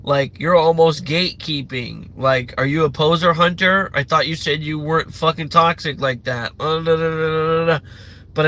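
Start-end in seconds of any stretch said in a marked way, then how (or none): none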